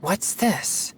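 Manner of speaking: surprised. Acted. Exorcist spots an eerie mist on the hill, surprised. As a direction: catch your breath at something odd.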